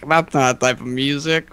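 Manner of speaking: ordinary delivery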